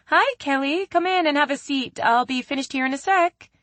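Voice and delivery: a receptionist-bright office voice for a visitor; sudden switch to polite office manner; business tone. A narrator's reading. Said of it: "Haley's tone switched to business".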